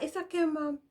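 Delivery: high, lightly voiced child; a hint of a kid calming mom down; kid soothing mom. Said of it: "I'd be like".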